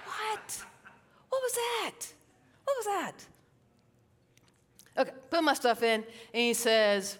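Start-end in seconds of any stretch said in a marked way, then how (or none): 0.0-3.3 high, incredulous what was that; mock disbelief. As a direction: gasp at her own words, mock shocked. Mocks her own reply.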